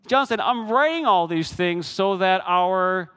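high, loud, driving sermon voice; full-force preaching. Acted. Preacher firing up the congregation about joy. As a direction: preach it out to the back row.

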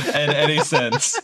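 talking through laughter; laughing while protesting, loud. Real, not acted.

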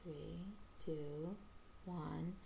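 softly spaced-out words; slow, soft countdown. Retold or imitated. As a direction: count down gently, leaving pauses. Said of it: "slowly, softly says".

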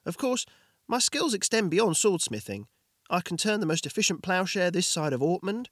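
high, bright-eyed salesman blacksmith; eager blacksmith voice. Acted. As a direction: pitch the smith's services eagerly, voice up high. Blacksmith Jorgin touting his services.

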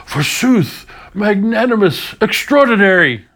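high, loud, grand theatrical declaiming; hammy stage actor. Shown as a demonstration. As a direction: ham it up like an old Shakespearean actor. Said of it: "a Shakespearean actor would have to go".

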